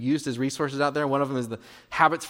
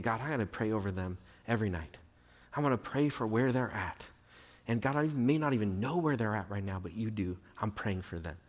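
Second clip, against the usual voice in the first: softer, lower, a parent praying outside a door; hushed prayer; 6 semitones lower, 6 dB quieter than usual. Pretend. Parent praying for a child at night, hushed and softer. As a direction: pray it under your breath, tender.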